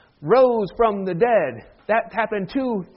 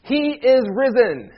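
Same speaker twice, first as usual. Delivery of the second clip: slow, raised Easter proclamation; leader's call in a responsive liturgy; liturgical call; 4 semitones higher, 4 dB louder, slower than usual. Shown as a demonstration. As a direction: proclaim it for the congregation to answer. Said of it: "I'm gonna read this line to you".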